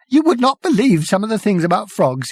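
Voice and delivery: breathless high giggle; gasping. Acted. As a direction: gasp through the laughter. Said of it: "he gasped".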